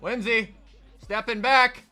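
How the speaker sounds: excited sports commentator calling the game; hyped game-day announcer; play-by-play. Acted. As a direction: call the action like a live broadcaster. Live play-by-play of his game.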